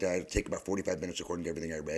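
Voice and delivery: normal talking voice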